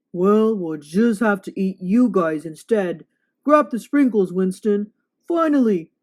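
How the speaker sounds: loud greedy monster voice; hungry monster. Acted. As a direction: threaten them with a big hungry roar. Monster deciding to eat boys.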